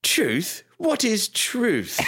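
put-on high-pitched, drawn-out high-class English accent; hammed-up upper-class English accent; Pilate, high-class English accent. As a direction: play a Monty Python Englishman, high and slow. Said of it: "can I please hear your Pilate impression".

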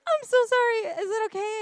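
high, loud, gushing apology; flustered apology. Retold or imitated. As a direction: blurt a mock-panicked sorry, pitched high. Retold flustered apology after first kiss.

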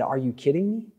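casual, disbelieving retort; a mock-casual eye-roll of a line; incredulous modern retelling. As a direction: scoff like you can't believe it. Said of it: "He didn't say it like that. That's my version".

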